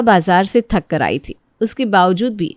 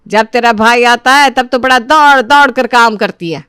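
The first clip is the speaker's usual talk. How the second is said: loud, scolding mother-in-law; indignant, heated telling-off; angry berating; 5 dB louder than usual. Acted. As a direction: pile on accusations, building louder.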